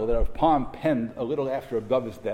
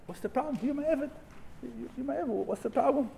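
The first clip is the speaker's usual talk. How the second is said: higher and softer, God gently chiding the prophet; gentle divine voice; 10 semitones higher, 8 dB quieter than usual. Acted. God reassures weary prophet Yeshaya, high and soft. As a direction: reassure him like a patient master: what's the problem.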